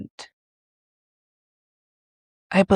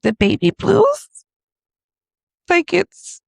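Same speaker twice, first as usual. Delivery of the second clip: higher, louder, rising disbelief; incredulous; 8 semitones higher, 7 dB louder than usual. Retold or imitated. New mother doubting the doctor's label, incredulous. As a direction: throw the phrase back as a question.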